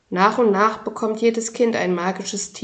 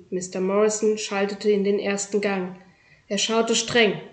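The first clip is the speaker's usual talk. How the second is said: usual speaking voice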